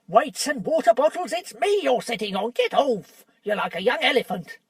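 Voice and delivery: dwarf complaining to someone sitting on him; indignant dwarf. Acted. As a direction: grumble from underneath. Trumpkin squashed in the dark.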